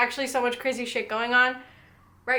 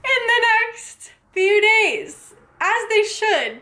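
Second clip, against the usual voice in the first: high, slow sassy stretch; pointed sing-song jab; sassy drawn-out; 8 semitones higher, 3 dB louder, much slower than usual. Real, not acted. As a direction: drag it out high and knowing. Sassy emphasis on readers.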